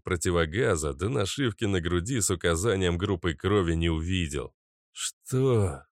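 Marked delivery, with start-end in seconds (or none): 4.9-5.8 mumbling. Acted. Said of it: "начал мямлить Каша".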